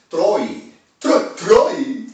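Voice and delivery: mock the name Troy, voice rising. Shown as a demonstration. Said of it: "Háblame en criollo".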